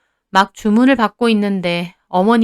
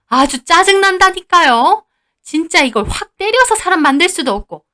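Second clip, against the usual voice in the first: irritated venting; 8 semitones higher, 3 dB louder than usual. Acted. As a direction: vent full annoyance. Mother-in-law vents annoyance. (짜증이 잔뜩 난 말투)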